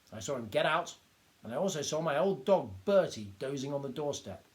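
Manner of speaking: lightly voiced little doctor, worked up; indignant little doctor. A narrator's reading.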